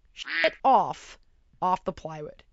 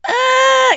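put-on high scared child's scream; terrified child scream; 14 semitones higher, 12 dB louder than usual. Son terrified by fireworks, high scream. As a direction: scream like a frightened little boy. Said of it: "Phoenix is like".

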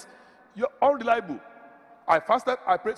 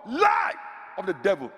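shouted denunciation. Real, not acted. Preacher rejecting the tradition of never questioning God, shouted. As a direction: cry it out as a verdict.

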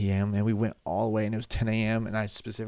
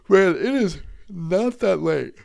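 yawned out, high and slow; yawning; 9 semitones higher, 4 dB louder, slower than usual. Real, not acted. Yawning to viewers that it is not that late. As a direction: stretch the words through a big yawn.

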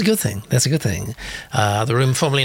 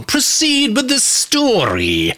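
slow, high, drawn-out hammed-up demand; theatrical command; 12 semitones higher, much slower than usual. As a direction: declaim it with mock impatience. Hamming up a listener's impatient comment.

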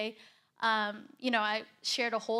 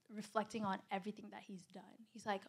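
usual speaking voice

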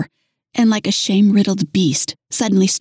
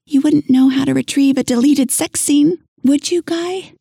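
higher, sweet simpering ask; tiny coy voice; 7 semitones higher than usual. A narrator's reading. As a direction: coax him like a shy flirt. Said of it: "In a tiny, coy voice".

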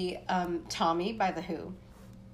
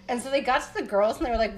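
ordinary talk, nothing marked

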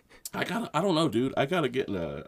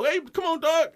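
impatient; 13 semitones higher than usual. Retold or imitated. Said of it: "but, like, you know".

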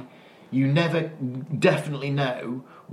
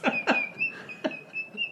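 laughing; 7 dB quieter than usual. Real, not acted.

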